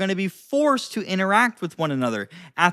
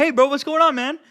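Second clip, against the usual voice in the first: much higher, louder upbeat greeting; raised friendly tone; 8 semitones higher, 4 dB louder than usual. Shown as a demonstration. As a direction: lift the voice high and bright. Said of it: "if I raise my tone".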